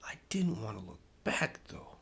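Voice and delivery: hushed suspense whisper. A narrator's reading. Narrating turning around to the monster, hushed whisper. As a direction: breathe it like a ghost story.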